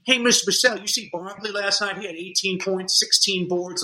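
put-on high-pitched little boy's voice; imitated schoolboy. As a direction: play an eager kid reporting game stats. Imitates second-grader reporting basketball score.

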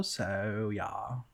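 creaky low vocal fry. Shown as a demonstration. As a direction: drag the words in a vocal fry. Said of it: "I can do, like, a vocal fry too".

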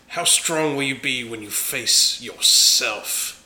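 slow, loud in-character challenge; dramatic challenge. Acted. Player confronting foe with his inner demon, slow and dramatic. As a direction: deliver it slowly and forcefully, word by word.

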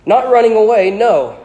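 curt, loud reply to the portrait; short and curt. A narrator's reading. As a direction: snap it back, cutting him off. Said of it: "said Harry shortly".

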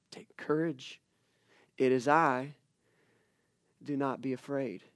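soft, low, steadying answer; quiet, soothing scripture quote; calm reassurance. Acted. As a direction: settle the panic gently, with long pauses. Calm answer to acted panic.

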